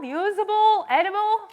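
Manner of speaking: usual speaking voice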